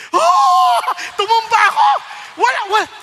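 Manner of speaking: high, loud exclaimed cry of falling; excited shout. Real, not acted. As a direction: relive the fall with a loud burst. Reliving falling down.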